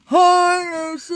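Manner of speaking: high, loud mock greeting; punchline greeting. Delivering the joke's punchline to the co-host, high and loud. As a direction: sing out the punchline cheekily. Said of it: "I don't go".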